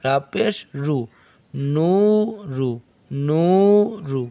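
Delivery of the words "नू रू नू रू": नू रू stretched very long; slow recitation drill; drawn-out vowels. Shown as a demonstration. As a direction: hold each ऊ in नू रू slowly. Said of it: "नून वाव पेश नू".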